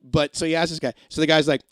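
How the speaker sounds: ordinary delivery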